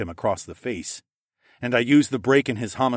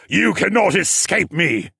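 loud, slow, higher threatening roar; reptilian shout; 9 semitones higher, 6 dB louder than usual. A narrator's reading. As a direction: bellow the threat like a monster. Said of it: "Siskorn shouted".

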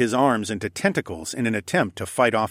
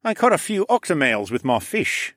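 higher, amused dragon voice; chuckling; 6 semitones higher than usual. A narrator's reading. Green dragon explains his odd catch, chuckling. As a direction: tell it with a chuckle. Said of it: "the green dragon chuckled".

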